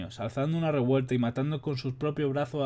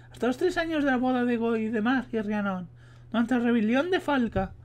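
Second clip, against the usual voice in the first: man doing a woman's voice, much higher; high put-on female voice; voz de mujer; 12 semitones higher than usual. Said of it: "estoy hasta los huevos de poner la voz de mujer". (hombre imitando voz de mujer)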